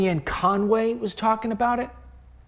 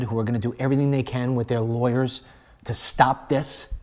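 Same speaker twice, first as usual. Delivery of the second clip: ordinary delivery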